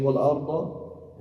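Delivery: "wal arda" slow, stretched, final a clear; "wal arda" said slowly with a fatha; fatha ending. Shown as a demonstration. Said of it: "it has a fatha".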